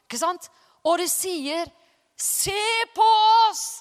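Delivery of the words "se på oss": commanding call. Retold or imitated. Apostles call to lame man.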